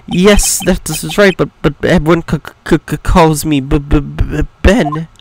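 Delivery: jittery, broken delivery with repeated first sounds; nervous stammerer. Acted. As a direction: titter and trip over every hard consonant. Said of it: "This guy is so nervous".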